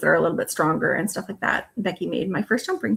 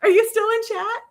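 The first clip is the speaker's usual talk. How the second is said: put-on whiny kid calling mom; 12 semitones higher than usual. Sisters joking like bickering kids.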